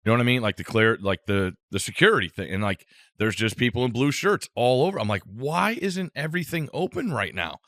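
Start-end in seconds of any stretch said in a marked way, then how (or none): 5.2-7.6 high-pitched baffled complaint; retold exasperation, pitched well up; exasperated. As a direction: plead for an explanation in disbelief. Retelling his exasperation at the airport.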